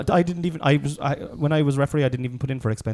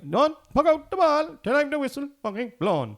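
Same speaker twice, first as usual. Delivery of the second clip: bossy referee; 12 semitones higher, 4 dB louder than usual. Retold or imitated. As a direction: bark the order like a pompous ref. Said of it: "a ref going".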